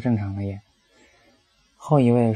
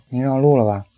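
raised, louder call to the cab driver; shouting at the driver; 2 semitones higher, 6 dB louder than usual. Retold or imitated. Passenger shouts at the detouring taxi driver, loud. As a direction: call out sharply, catching him out. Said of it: "我喊".

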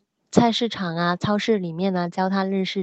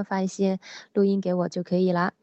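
normal talking voice

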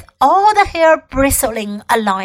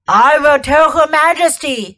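wolf voice; 2 semitones higher, 4 dB louder than usual. A narrator's reading. Wolf speaking.

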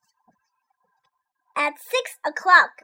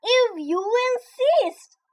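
skunk; 9 semitones higher, slower than usual. A narrator's reading. Skunk replies.

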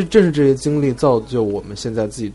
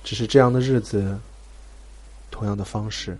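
mournful lyric recitation; 7 dB quieter than usual. Pretend. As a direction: recite the lyrics like a sad poem over music. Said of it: "歌词朗诵".

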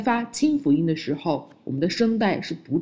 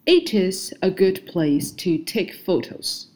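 slow model sentence; slower than usual. Shown as a demonstration. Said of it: "第四句".

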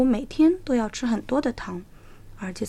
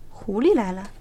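ordinary delivery

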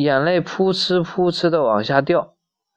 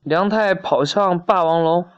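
ordinary delivery, nothing marked

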